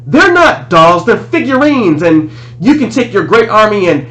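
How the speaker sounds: put-on high, heated boyish outburst; indignant protest. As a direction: protest hotly, pitch shooting up. Boy indignantly protests to villain.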